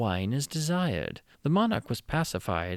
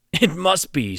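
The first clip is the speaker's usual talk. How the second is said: loud, high quick assent; eager agreement; 6 semitones higher, 9 dB louder, slower than usual. Acted. The king eagerly agrees to the scheme, loud and high. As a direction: clinch it with gusto. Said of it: "said the king".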